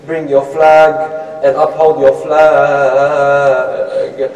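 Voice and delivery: loud chanted storytelling with long-held syllables; declaimed sing-song lament, swelling in force; lamentation recital. A narrator's reading. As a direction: intone the tale slowly, stretching the line endings.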